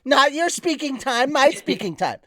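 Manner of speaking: high, loud sassy scolding; mock scolding. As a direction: hold the talking stick and scold. Scolds co-host for talking.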